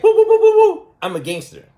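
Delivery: high, loud mock-tough boasting with a laugh; mocking Nicole. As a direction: ham up a silly wannabe gangster. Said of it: "And Nicole's like".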